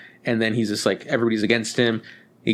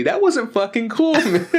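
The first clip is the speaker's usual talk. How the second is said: higher, breaking into a laugh; laughing mock complaint; 9 semitones higher than usual. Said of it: "He was like".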